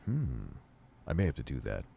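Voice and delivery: stoner voice. Real, not acted. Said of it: "you know I'm stoned right now".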